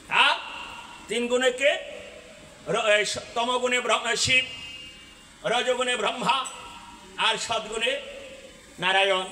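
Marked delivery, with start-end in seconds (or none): none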